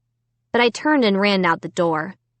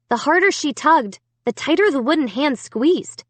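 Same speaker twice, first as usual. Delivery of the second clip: ordinary delivery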